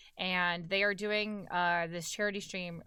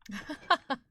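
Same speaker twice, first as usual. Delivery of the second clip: short, higher-pitched laugh; amused laugh; 6 semitones higher, 4 dB louder than usual. Real, not acted. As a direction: laugh briefly at your own joke. Laughs at own competitive joke.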